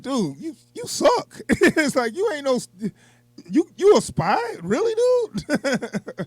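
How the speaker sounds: high, squeaky mocking voice, slow on the last words; mocking villain. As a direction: ham up a scornful villain's jeer. Imitating villain calling him out.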